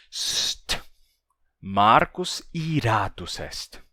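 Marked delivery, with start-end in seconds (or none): 0.1-0.8 unvoiced sharp shush; hushing shh. Marcus hushes his singing sister, a sharp whispered shush. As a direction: hiss a shush to silence someone.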